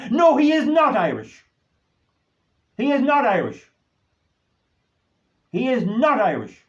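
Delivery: high and loud, hammered home, easing down each time; angry insistence. Real, not acted. Rejecting the claim he's Irish, angry and insistent. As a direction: slam the door on the claim.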